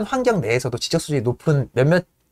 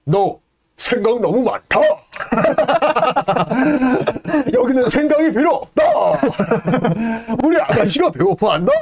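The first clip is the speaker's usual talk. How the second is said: Yank scolding; 9 semitones higher than usual. Retold or imitated. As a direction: scold the thinker like a dim brute. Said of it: "지책을 하는 거예요". (롱을 꾸짖는 양크, 높고 느린 목소리에 웃음)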